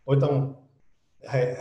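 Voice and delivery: ordinary delivery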